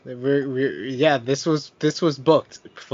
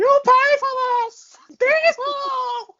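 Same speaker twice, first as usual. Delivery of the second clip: much higher sputtering foiled villain; Paul Bearer voice; slower than usual. Retold or imitated. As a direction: squeal the curse in a high wail. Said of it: "you gotta do the voice".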